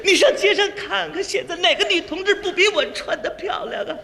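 high-pitched plaintive complaint; tearful wife. Acted. Wife complains of shabby clothes, tearful. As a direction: whine in mock tears. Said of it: "就别哭了".

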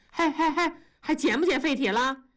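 high call after her. Acted. 同志在后面喊住文丽，高声叫. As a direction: call out to stop her leaving. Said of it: "同志在后面喊".

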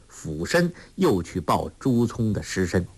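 ordinary delivery, nothing marked

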